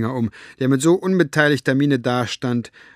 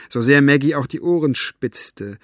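plain reading voice

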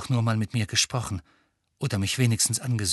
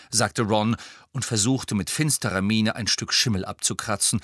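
normal talking voice

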